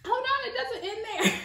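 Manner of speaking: high, playful hold on; teasing buildup. Real, not acted. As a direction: tease the next twist. Teasing the next twist.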